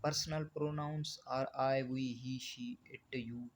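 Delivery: very slow, word-by-word reading of the definition; slow dictation. Shown as a demonstration. Teacher dictating a definition to the class, very slow and even. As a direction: spell the definition out slowly for students to copy.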